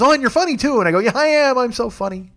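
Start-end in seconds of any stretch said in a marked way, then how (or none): 0.0-0.8 very high-pitched gushing imitation; mock-delighted squeal of praise; imitating amused passenger. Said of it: "she laughed. She goes". 1.0-2.3 high-pitched playful self-praise; jokey boast that softens at the end; mock bragging. Playing along with her praise.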